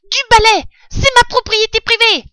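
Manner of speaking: very high, loud rat shriek; yelling rat. A narrator's reading. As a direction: shoo them off in a piercing rat voice. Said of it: "hurlait le rat". (rat qui hurle, voix suraiguë)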